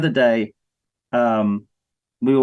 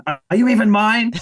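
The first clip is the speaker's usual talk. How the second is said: mock disbelief; 9 semitones higher, 3 dB louder than usual. Mock disowning of own kids.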